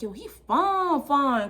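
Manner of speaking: "fine, fine" drawn way out in admiration; drawn-out slang admiration. Retold or imitated. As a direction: stretch both words in "fine, fine" high and long. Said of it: "that's how we talk".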